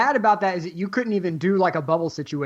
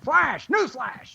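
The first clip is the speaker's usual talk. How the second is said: high newsreel announcer; old newsreel; 8 semitones higher, much slower than usual. Acted. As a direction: sell the headline like 1940s radio. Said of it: "That's right".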